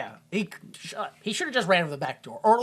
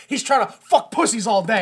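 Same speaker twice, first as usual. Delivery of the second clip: ordinary talk, nothing marked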